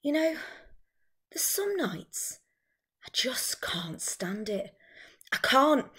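slow, hushed, near-whispered exasperation; Carol. Acted. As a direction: confide it haltingly, with long pauses. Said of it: "the one I've chosen is Carol's monologue".

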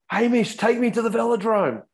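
retold yell from a tired rider. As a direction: shout it to a mate from the bike. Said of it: "as I yelled out".

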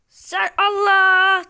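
long, slow, loud yell of disbelief; exasperated drawn-out shout. Real, not acted. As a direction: stretch it out and shout it at the screen. Stretched cry at the game.

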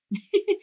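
quick self-amused laugh; a brief embarrassed giggle; short laugh. Real, not acted. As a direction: laugh at yourself for repeating. Laughs at her own repetition.

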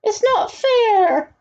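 high, slow stretched-out whine; spoiled duke wailing that it is unfair; tantrum wail. A narrator's reading. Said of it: "cried out".

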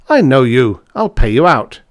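narrated character's angry outburst; furious threat. A narrator's reading. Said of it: "said von Hartmann furiously".

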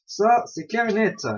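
ordinary delivery

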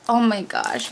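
usual speaking voice

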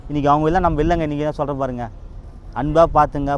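ordinary delivery

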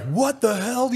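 high imitated angry outburst; be the pissed-off sergeant. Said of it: "Doug's pissed".

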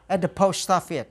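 "At the post office" run together, words linked smoothly; linked reading. Shown as a demonstration. Teacher shows students how to link "At the post office". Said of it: "Đọc nối các bạn".

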